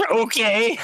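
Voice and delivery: high, laughing okay, brushing off a threat; giggling dismissive okay. Retold or imitated. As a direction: laugh it off right at him. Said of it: "me just going".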